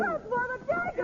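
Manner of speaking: frightened cry of warning; alarmed warning. Acted. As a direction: warn him of the blade. Warning of a dagger.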